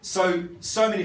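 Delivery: ordinary talk, nothing marked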